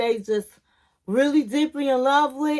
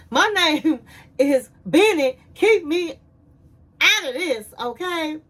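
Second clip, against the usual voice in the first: louder, a touch higher, imitating him backing off; mock defensive man; 4 semitones higher, 3 dB louder than usual. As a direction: play the guy saying keep me out of it. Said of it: "he was looking like".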